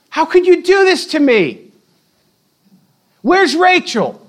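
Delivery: high, pleading outrage; Jacob's high, aghast cry at Leah; mock outrage. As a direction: cry out where's Rachel, betrayed. Jacob confronting Leah, voiced.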